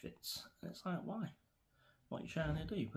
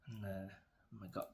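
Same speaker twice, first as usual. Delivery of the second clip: ordinary talk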